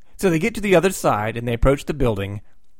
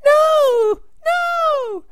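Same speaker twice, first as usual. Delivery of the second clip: much higher, louder repeated shrieks; screaming no; 5 dB louder, much slower than usual. Retold or imitated. Sue to her skeleton parents, high loud shrieks. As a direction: shriek in horror. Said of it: "she screams out".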